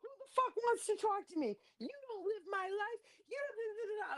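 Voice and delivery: very high mock rant trailing into da da; mock screaming rant. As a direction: squeal her dramatic threat. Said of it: "her little screaming crazy rants".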